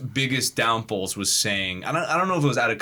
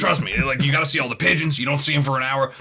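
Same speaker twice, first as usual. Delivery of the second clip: fast, louder ranting conspiracy host; frantic conspiracy rant, pitched up; Alex Jones impression; 4 semitones higher, 4 dB louder, faster than usual. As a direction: rant like Alex Jones selling a wild theory. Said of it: "That's exactly what he sounds like".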